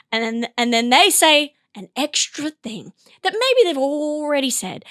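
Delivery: ordinary talk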